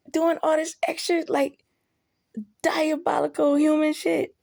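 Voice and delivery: high-pitched mock outrage at the dog; exasperated rant. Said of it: "I said".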